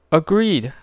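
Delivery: woman corrects Drax sharply; Gamora voice, cutting in. A narrator's reading. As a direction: snap in to clarify.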